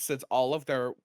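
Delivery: usual speaking voice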